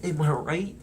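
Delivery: a hint of a slacker drawling; mock skater cool; too cool for school. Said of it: "some too cool for school guy".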